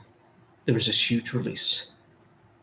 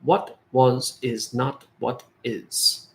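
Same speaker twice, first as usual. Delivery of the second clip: slow quoted answer; much slower than usual. Retold or imitated. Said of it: "his answer to me was".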